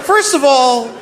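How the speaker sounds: high, mock-offended objection; indignant retort. Comedian telling the president off on the phone, indignant. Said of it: "And I was like".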